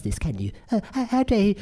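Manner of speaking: high-pitched mock indignation; mock outrage. Offended critic scolding for other people, mock outrage. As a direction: play up the offended stammer.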